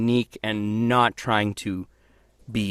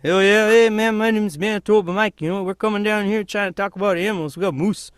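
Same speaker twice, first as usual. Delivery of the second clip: put-on high-pitched Canadian hoser accent; chatty cartoon Canadian hoser voice; 9 semitones higher, 4 dB louder than usual. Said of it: "doing, like, a hoser thing".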